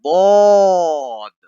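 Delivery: ضاد stretched slow and thick, with a d-like sound; ضاد drawn out heavily; heavy drawn zwaad. Shown as a demonstration. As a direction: hold ضاد long and heavy. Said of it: "دال کا sound کرتا ہے".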